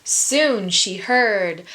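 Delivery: usual speaking voice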